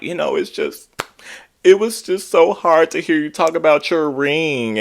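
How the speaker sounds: mock crying. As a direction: imitate her fake tears. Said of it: "fake dramatic pause and she starts crying".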